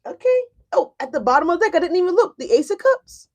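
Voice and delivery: surprised laughing. Real, not acted. Surprised by card at bottom.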